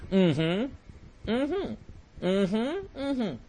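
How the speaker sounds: very high approving hums, spaced out; mock crowd mm-hmms. Host imitates a rally chorus, mock approving hums. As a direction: imitate a whole chorus murmuring agreement. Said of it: "a chorus of mm-hmms in the background".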